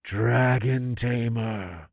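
slow, drawn-out droning chant; echoing cultists. A narrator's reading. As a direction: echo the leader like an entranced congregation. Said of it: "the rest of the cultists echoed".

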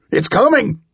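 high, urgent warning shout. A narrator's reading. Man warning whoever he just crashed into, shouting. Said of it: "I shouted".

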